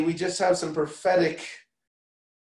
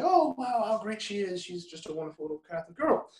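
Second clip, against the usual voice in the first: mock gushing parents; 6 semitones higher, 4 dB quieter than usual. Mocking the parents' approval.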